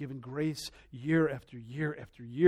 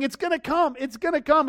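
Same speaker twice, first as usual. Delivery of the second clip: Noah warning; 10 semitones higher, 4 dB louder than usual. Retold or imitated. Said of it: "He's saying".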